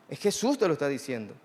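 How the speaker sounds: ordinary talk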